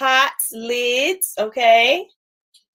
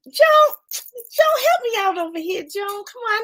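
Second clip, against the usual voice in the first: high, pleading call for help; playful pleading; 9 semitones higher than usual. Real, not acted. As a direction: beg a friend to jump in. Calling on a viewer for help.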